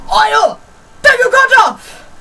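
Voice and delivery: high, loud, drawn-out mock order; reenacted shout. Reenacting lady yelling at pupil from across the room, scolding shout. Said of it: "I'm going to reenact this".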